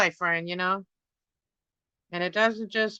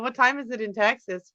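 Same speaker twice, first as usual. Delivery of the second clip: ordinary delivery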